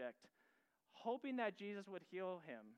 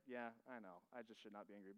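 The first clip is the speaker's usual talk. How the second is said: much softer and lower, a guilty mumble; sheepish muttered admission; 7 semitones lower, 8 dB quieter than usual. Retold or imitated. Preacher imitating his own inner voice, a guilty mutter. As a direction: admit it under your breath.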